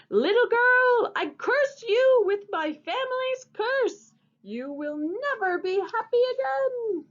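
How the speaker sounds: archduke's curse. Acted. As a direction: hurl the curse at her in a rage. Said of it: "hurled the box out the window and shouted".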